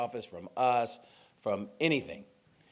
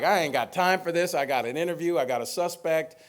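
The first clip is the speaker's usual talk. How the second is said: busy detective brush-off; 6 semitones higher, 6 dB louder than usual. Retold or imitated. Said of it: "he's probably like".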